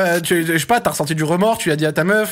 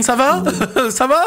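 higher, teasing "ça va?"; mocking worried partner; 6 semitones higher than usual. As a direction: mimic her checking in, amused. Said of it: "ça s'arrêtait pour me dire, euh, si c'était ok".

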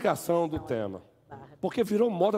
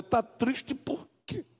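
slow, higher mock question; mocking the offender; 7 semitones higher, 4 dB quieter, much slower than usual. Pastor mimics offender asking the hurt sister. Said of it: "a pessoa que feriu perguntar a você".

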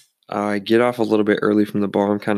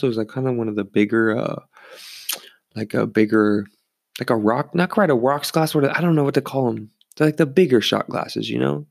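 ordinary delivery

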